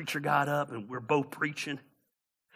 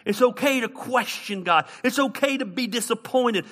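raised, high-pitched pulpit encouragement; impassioned preaching; 8 semitones higher, 4 dB louder than usual. Real, not acted. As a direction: urge it on the congregation in a lifted preacher's voice.

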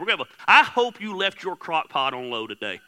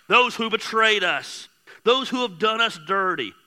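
ordinary delivery

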